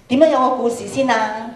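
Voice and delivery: normal talking voice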